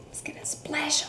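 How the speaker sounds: hushed whisper of suspense. Acted. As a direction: lean in and whisper to the kids.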